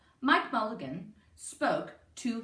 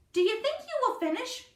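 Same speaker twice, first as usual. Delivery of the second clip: put-on high, eager child's voice; a small boy piping up; little boy; 12 semitones higher, 4 dB louder than usual. A narrator's reading. Little boy asking Mike, in a high child's voice. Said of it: "he said to Mike Mulligan".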